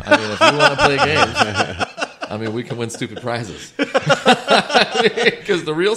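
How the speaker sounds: high laughing words, slowing. Real, not acted. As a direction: laugh while joking. Laughing joke about cocaine.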